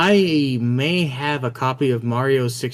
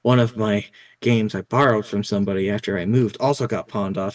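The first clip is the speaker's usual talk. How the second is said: usual speaking voice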